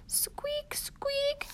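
high, tiny whispered squeak; whispered mouse squeak. Acted. Peanut the mouse squeaks as Jack leaves, high whispered squeak. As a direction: squeak softly like a little mouse.

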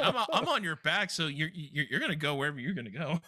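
usual speaking voice

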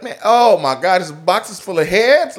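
high, loud horrified gasp of a thief; shocked thief. Retold or imitated. Thief opening the box of heads, horrified and loud. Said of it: "they're like".

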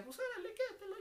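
very high innocent voice; naive kid. Retold or imitated. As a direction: hand it over like a trusting boy. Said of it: "decía". (él de niño ingenuo cediendo el teléfono, voz muy aguda)

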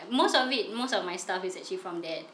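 ordinary talk

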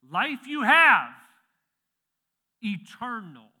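drawn-out, high, very loud proclamation; emphatic proclamation. Retold or imitated. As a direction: call it out boldly, pausing before ETERNAL. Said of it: "But he's like, "Hey".

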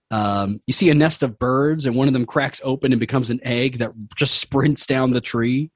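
usual speaking voice

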